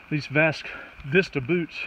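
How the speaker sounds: ordinary delivery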